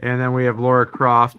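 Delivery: ordinary talk, nothing marked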